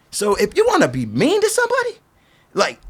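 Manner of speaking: high-pitched, indignant reproach; impassioned rebuke. Retold or imitated. Impassioned retold rebuke of mean skateboarders.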